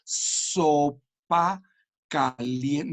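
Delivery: "una sopa caliente" stretched out slow, syllable by syllable; split-up words. Shown as a demonstration. Showing the wrong way of splitting words apart, very slow. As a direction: drag the words out piece by piece, very slowly. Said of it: "no recomiendo absolutamente partir las palabras".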